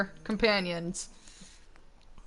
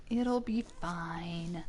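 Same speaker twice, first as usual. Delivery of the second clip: slow whispered "It'll be fine."; 3 semitones lower, 8 dB quieter, much slower than usual. Real, not acted. Repeating reassurance to the co-host, slow whisper. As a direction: whisper it slowly to yourself. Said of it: "whispered repeat after laugh".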